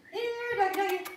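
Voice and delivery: high sing-song call to a dog; talking to a baby. Acted. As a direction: coax the dog in cooing baby talk. Calling a spirit dog.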